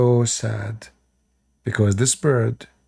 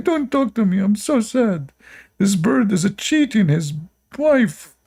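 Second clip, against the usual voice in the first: higher mock-whiny sulking prophet; mock sad prophet; 9 semitones higher than usual. Mock Sulaiman answering his companions, whiny and higher. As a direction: whine it like a heartbroken sulker. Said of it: "Prophet of Allah, what's wrong with you?".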